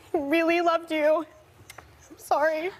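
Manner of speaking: fighting back real tears; choked up. Real, not acted. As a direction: break down mid-tribute and apologize. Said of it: "It's okay, baby. Take your time".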